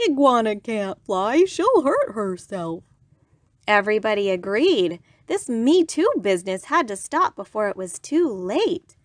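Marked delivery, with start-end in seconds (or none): 0.1-2.8 loud, slow, put-on walrus voice; worried walrus. A narrator's reading. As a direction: warn heavily, dragging it out as a walrus. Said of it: "worried Walrus".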